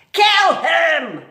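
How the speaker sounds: high, loud yell; shouted order. Acted. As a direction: scream it. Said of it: "shouted Cook".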